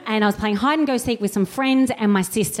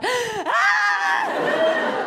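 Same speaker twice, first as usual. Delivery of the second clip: high shocked scream of disgust; horrified shriek. Retold or imitated. Kid seeing her arm out of the cast, a high shocked shriek. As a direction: yelp at the sight.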